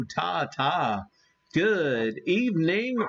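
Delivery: ordinary delivery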